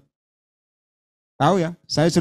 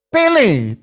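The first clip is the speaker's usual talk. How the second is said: commanding; 7 semitones higher, 6 dB louder than usual. Retold or imitated. Pastor retells God's command.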